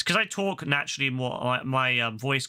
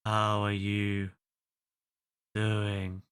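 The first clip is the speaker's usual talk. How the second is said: Marshall narrator impression; 6 semitones lower, much slower than usual. Streamer trying the narrator's voice again, lower and slow. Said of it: "if I let my, like, voice drop".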